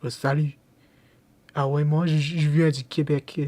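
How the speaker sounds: a Quebecer introducing himself, in a hammed-up Québécois accent. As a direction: play a proud Quebecer greeting you. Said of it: "il parle comme ça".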